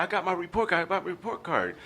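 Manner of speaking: put-on high eager kid repeating himself; be the thrilled boy showing off; childlike excited voice. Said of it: "I said".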